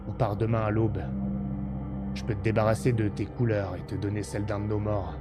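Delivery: low, quiet, weary deserter; low and quiet, tempting him away; gruff deserter soldier. Acted. Blond deserter soldier speaks low.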